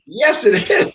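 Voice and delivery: high, loud, playful insistence; acted exasperated contradiction; mock insistent. Retold argument with disbelieving wife.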